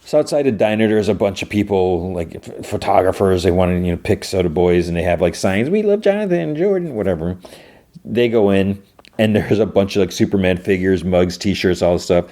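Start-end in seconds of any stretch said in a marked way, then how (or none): 5.7-6.9 high-pitched mock fan chorus; gushing fans. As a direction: imitate adoring fans in a much higher voice. Voicing fans' signs outside the diner.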